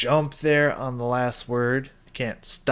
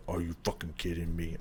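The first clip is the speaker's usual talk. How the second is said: imitating the low, softer drop; lower, muffled quote; 6 semitones lower, 8 dB quieter than usual. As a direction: echo the line low and soft like an old tape. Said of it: "drops off in that lower".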